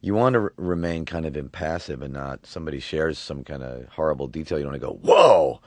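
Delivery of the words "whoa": loud startled gasp of a word; mock shocked whoa. Shown as a demonstration. Therapist's wrong reaction, shown as a loud startled whoa. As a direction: overreact to a horrible detail, loud.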